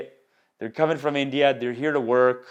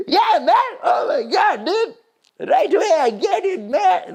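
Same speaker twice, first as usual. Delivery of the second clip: very high, loud hammed-up bro yelling; excited Iraqi guy; 5 dB louder, slower than usual. Comic retelling of Iraqi guy boasting he gets laid, excited. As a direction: imitate a wildly thrilled guy with an accent. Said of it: "He got so excited".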